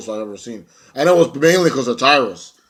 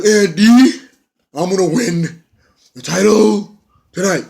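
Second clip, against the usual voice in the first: higher, louder mock brag to Andy; mock Tyrus taunt; 8 semitones higher, 6 dB louder, slower than usual. Wrestler boasting to Andy, higher cocky taunt. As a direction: ham up a cocky wrestler promising the title.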